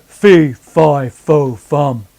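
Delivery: low, big booming giant; teacher demos an open-throated giant voice. Shown as a demonstration. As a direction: boom it out like the beanstalk giant. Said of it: "the giant from Jack and the Beanstalk".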